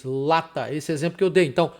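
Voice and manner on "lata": "lata" with a held opening L, lower; initial L. Shown as a demonstration. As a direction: linger on the L in "lata". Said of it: "como no início de uma palavra".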